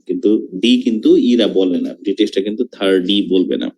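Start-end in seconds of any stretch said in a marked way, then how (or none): none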